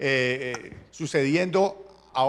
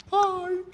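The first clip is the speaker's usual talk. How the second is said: high whine. Acted. King whining, imitated.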